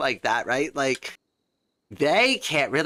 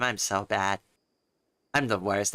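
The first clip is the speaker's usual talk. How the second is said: ordinary delivery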